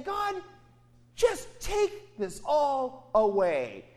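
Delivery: high then slow, drawn-out begging; weary plea. Acted. Jesus in the garden begging God to take it away. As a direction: stretch it out, pleading for relief.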